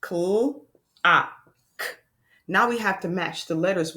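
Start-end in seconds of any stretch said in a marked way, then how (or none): 0.0-1.9 clock split slowly into cl, ah, k; segmenting sounds for children; sounds tapped out. Shown as a demonstration. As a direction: tap out each sound then blend. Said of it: "Listen to the sounds".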